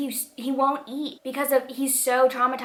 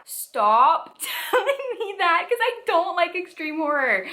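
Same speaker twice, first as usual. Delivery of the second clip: laughing exasperation; 9 semitones higher than usual. Real, not acted. Reader begging recommenders to stop telling her, exasperated and laughing. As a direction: beg them through laughter, pitch way up.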